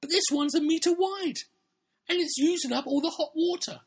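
very high, loud squealing complaint; distressed Emily. Acted. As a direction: protest in a hammed-up falsetto girl's voice. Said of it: "Emily replied".

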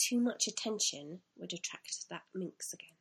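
ordinary delivery, nothing marked

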